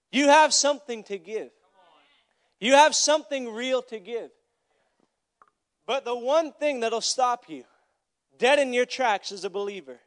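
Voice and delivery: loud, forceful sermon delivery; emphatic preaching. Real, not acted. Preacher exhorting believers that they can give, forceful. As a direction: drive each phrase home with weighty pauses.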